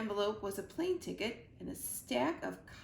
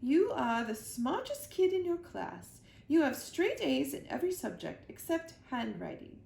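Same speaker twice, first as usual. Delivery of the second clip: higher, louder flattering queen; 6 semitones higher, 4 dB louder than usual. A narrator's reading. The Queen flatters Mac to win the favor, grand. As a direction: praise him grandly. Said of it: "said the queen".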